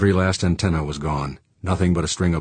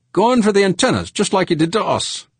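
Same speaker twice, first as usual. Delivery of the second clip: high, loud alarmed realisation; alarmed call; 4 dB louder than usual. A narrator's reading. George warning the pilot, loud alarm. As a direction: cry out the attacker's plan. Crewman calling out the attack.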